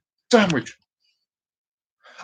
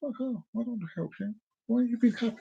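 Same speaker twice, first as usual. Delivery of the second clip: soft grumbling of a reluctant worker; mock miserable muttering; under the breath; 7 dB quieter, faster than usual. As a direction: grumble it softly to yourself. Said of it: "under their breath, they're thinking".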